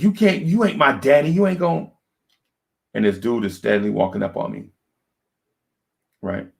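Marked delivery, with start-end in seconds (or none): 0.0-1.9 high, indignant mock wife voice; arguing wife snapping back, high and defiant. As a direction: throw back a high-pitched comeback. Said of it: "She's like".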